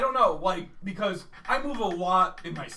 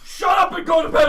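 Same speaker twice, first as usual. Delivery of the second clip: loud, higher irritated order; annoyed shout; 10 semitones higher, 10 dB louder than usual. Retold or imitated. Roommate woken at night, annoyed loud order. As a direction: bark at a roommate to sleep. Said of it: "he's like".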